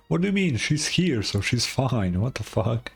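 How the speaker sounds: ordinary delivery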